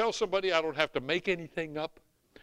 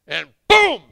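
sudden loud shout; 7 semitones higher, 17 dB louder than usual. Retold or imitated. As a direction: blast the word out. Sudden climax of retold story.

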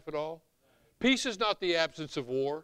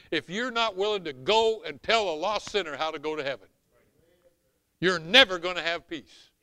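ordinary talk

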